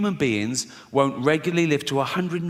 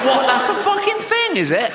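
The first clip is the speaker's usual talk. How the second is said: much higher, laughing through the outrage; laughing disbelief; 4 dB louder than usual. Real, not acted. Comedian recalls his childhood terror, laughing and much higher. Boy hears of willie cutter.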